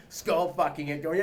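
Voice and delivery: usual speaking voice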